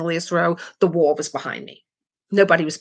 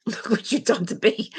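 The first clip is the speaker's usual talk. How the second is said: laughing mock blame; 4 semitones higher than usual. Real, not acted.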